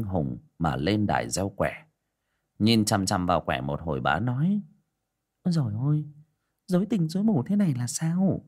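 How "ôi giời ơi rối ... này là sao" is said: put-on old shaman woman. A narrator's reading. Fortune-teller reading the omen.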